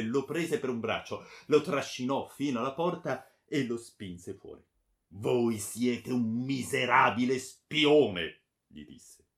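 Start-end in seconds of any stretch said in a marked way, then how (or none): 5.1-8.4 loud, slow insult; contemptuous anger. A narrator's reading. Baron shoving the friar out the door, contemptuous. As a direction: stretch the insult out with force.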